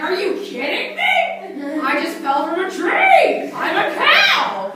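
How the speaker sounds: loud, high, slow outraged shout through laughs; laughing outraged shout; indignant cow. Acted. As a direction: yell it like an offended cow. Cow yelling at squirrel.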